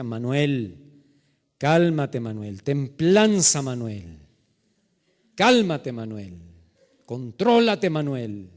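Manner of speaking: forceful commands, each name dropping low; straining patience. Retold or imitated. Man in a store fighting to stay calm, strained self-control. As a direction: burst on the command, then rein it in.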